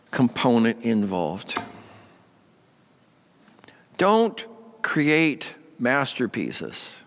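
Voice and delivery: ordinary talk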